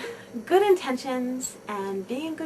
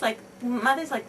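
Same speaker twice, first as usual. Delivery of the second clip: normal talking voice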